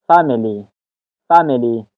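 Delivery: فامیلی stressed on "FAM"; first-syllable stress. Shown as a demonstration. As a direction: hit the opening syllable of فامیلی, "FAM-ily". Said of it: "استرس روی حرفی اف است یا هجای اول".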